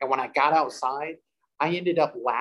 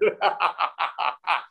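a burst of retold maniacal laughter; 4 semitones higher than usual. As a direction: cackle as if something has hold of you. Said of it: "that deep maniacal laugh".

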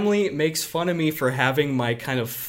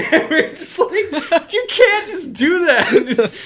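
much higher, slow, cracking with laughter; laughing hard; 13 semitones higher, 7 dB louder, slower than usual. Real, not acted. Retelling how they scolded dad, laughing high. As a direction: squeal through giggles.

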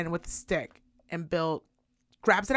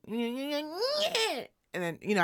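usual speaking voice

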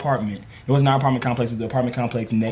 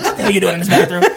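mock scolding; 6 semitones higher, 4 dB louder, much faster than usual. Mom bursting into bathroom.

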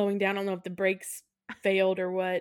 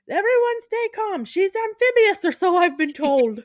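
high, loud Irish announcement; mock Irish accent; 11 semitones higher, 8 dB louder than usual. Bus driver Eamon to swimming passengers, loud mock Irish shout. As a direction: call out in a hammed-up Irish accent. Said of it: "this accent is not gonna be good".

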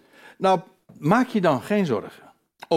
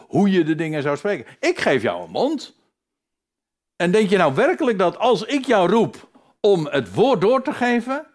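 louder, higher, driving preacher's voice as God; preaching at full force; 7 semitones higher, 5 dB louder than usual. Acted. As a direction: thunder out God's challenge to the doubter. Voicing God's challenge at full force. (vol vuur preken als God die Mozes terechtwijst)

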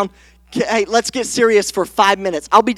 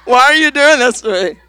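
ugly cry; 3 dB louder than usual. Shown as a demonstration. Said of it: "an ugly cry is this right here".

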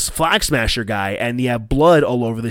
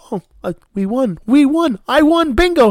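high and rising to a louder squeal of glee; gleeful madman; 12 semitones higher, 4 dB louder than usual. Retold or imitated. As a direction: cackle out the win like a giddy lunatic.

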